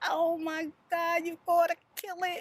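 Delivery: high, loud urgent daughter voice; daughter urging. Retold or imitated. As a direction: push mom to kill it. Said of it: "my daughter was like".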